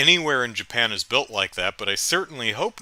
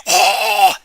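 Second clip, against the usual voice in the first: zombie groan; 8 semitones higher, 7 dB louder than usual. Acted.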